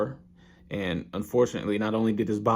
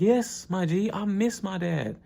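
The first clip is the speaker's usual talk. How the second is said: put-on higher, earnest boyish longing; eager Ippo voice; 10 semitones higher than usual. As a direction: pitch up, eager then wistful. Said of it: "Ippo's like".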